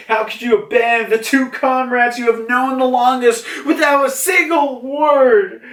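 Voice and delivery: high, wailing indignation; hammed-up hurt outrage; outraged rebuke. Momonosuke rebukes Luffy, retold.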